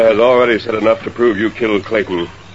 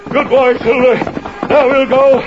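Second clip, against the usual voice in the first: excited urging; slower than usual. Acted. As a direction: drive the horse on, elated. Galloping off after the jump.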